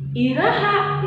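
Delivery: Iraha said high and drawn out; teacher showing the when question; stressed question word. Shown as a demonstration. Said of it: "Atau pananya waktu".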